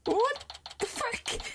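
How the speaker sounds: high, drawn-out bafflement; bewildered disbelief. Real, not acted. Streamer thrown by the character's voice, bewildered. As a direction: squeak it out slowly, totally thrown.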